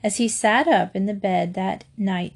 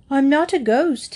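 higher-pitched bear voice; explaining; 5 semitones higher, 4 dB louder than usual. A narrator's reading. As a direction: protest lightly, in a higher voice. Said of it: "he explained".

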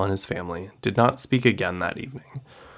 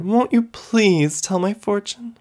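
higher, slow pleading; imploring; 11 semitones higher, 4 dB louder than usual. Acted. Daughter-in-law imploring her mother-in-law for a fortune. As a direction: beg her sweetly. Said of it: "she implored".

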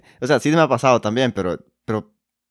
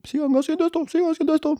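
very high squeaky fan voice; mock fan voice. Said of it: "ahí nos escriben".

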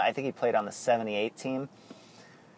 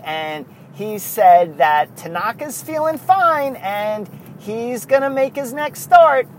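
higher, louder, slow eager reporter voice; Susan Waldman impression; 8 semitones higher, 5 dB louder, slower than usual. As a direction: lay out the update slowly, bright and insistent. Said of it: "Susan will go".

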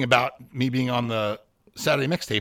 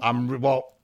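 ordinary delivery

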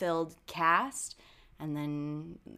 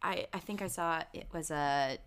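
ordinary delivery

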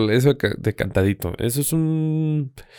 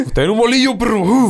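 higher, brash street voice; mock chilango hustler; 8 semitones higher than usual. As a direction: play a slick Mexico City hustler. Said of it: "a la verga".

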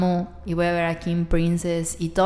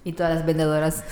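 usual speaking voice

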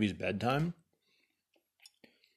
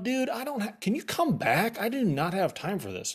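higher, retold exasperation; exasperated; 7 semitones higher than usual. Fending off the census worker, as a mock exasperated plea. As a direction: replay your impatience a touch high. Retelling his own exasperation.

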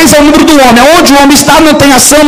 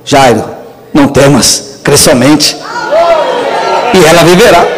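lower, steady reassurance; a hint of Jesus's calm voice; 7 semitones lower than usual. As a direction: speak as a gentle master comforting Jairo. (voz de Jesus, grave e serena, consolando Jairo)

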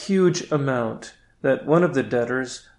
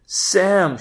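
higher, louder call across to the neighbour; shout; 6 semitones higher, 4 dB louder than usual. Acted. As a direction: call out Sam's name across the street. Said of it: "she shouts".

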